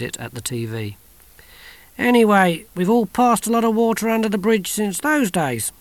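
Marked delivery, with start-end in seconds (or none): 2.0-5.7 put-on old grandfather, higher, closing the topic; brushing it off. A narrator's reading. Grandfather to Johnny, ending the talk, higher. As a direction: wave the past away.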